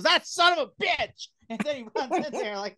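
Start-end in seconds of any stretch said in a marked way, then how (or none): 0.0-1.3 high, drawn-out imitation of an angry outburst; hammed-up angry quote; mock angry outburst. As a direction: blurt it high, imitating his fury. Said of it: "he's just so angry".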